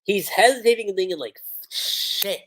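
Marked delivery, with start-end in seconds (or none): 1.7-2.4 voiceless, breathed-out mock thought; acted inner curse, under the breath; hushed realization. Reviewer voicing a hero's hesitant thought, hushed.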